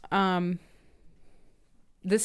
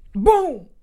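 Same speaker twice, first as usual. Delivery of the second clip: loud, high, explosive boom; sudden reveal boom; 7 semitones higher, 9 dB louder than usual. Retold or imitated. Telling the shock moment in the film, explosive boom. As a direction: punch it out like a blast.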